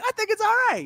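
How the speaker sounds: high-pitched mock protest; quoting himself defensively. Defending his accent to wife, high protest. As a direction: jump up high in self-defence. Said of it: "I'm like".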